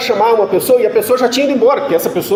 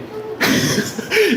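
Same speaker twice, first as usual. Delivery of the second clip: airy laugh; 11 semitones higher, 6 dB quieter than usual. Real, not acted. Laughs at his own story.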